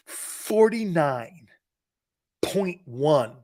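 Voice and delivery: drawn out, each number weighed; slow, stressed; slow emphasis. Real, not acted. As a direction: let the figure sink in slowly. Savouring the big salary figure.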